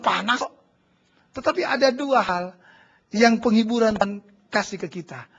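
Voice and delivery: ordinary talk, nothing marked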